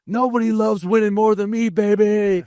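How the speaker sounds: high, excited coach celebrating a win; imitating Coach Q. Player retelling the coach after a big win, high gleeful cheer. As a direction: mimic the coach's gleeful locker-room cheer. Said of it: "be like".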